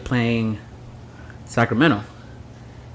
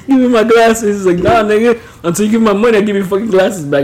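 higher, louder, laughing through a quoted quarrel; laughing mock argument; 9 semitones higher, 6 dB louder than usual. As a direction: act out the glasses standoff while laughing. Quoted argument over the glasses.

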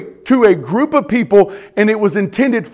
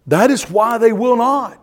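ordinary talk, nothing marked

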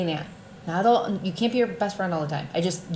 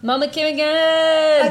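excited cheer; 10 semitones higher, 8 dB louder, slower than usual. Real, not acted. Cohost cheering the returning guest.

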